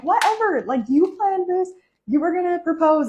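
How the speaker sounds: high, loud teasing accusation; playful accusation. Retold or imitated. Girlfriend accusing boyfriend of planning it, high and loud. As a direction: call him out, half laughing.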